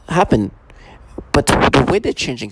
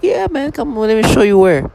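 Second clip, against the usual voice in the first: much higher, breezy "yeah man" welcome; upbeat imitated invitation, higher pitch; eager guy; 9 semitones higher than usual. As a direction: play the eager host, lightly. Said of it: "And he's like".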